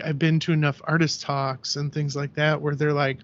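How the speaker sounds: ordinary talk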